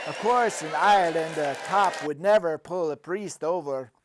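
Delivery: ordinary delivery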